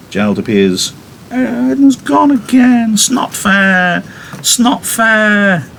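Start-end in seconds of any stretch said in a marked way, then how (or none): 1.3-5.7 put-on high, slow childish whine; whiny mock complaint. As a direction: draw out it's not fair like a sulking child. Mocking Gerald's entrance.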